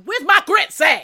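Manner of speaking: loud, high, slow Southern drawl. Retold or imitated. Mocking [name] at home, loud high Southern voice. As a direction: belt it like a Southern diva at home. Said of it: "when she gets home".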